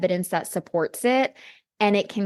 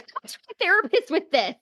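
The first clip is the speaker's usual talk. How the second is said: squeaky retelling; 10 semitones higher, faster than usual. Retold or imitated. Her retold reply.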